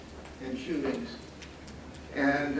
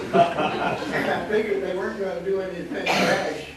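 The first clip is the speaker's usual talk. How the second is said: words pushed out through laughter, louder; laughing; 3 semitones higher, 7 dB louder than usual. Real, not acted. Recalling the Wallace encounter to the audience, laughing. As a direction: still laughing as you talk.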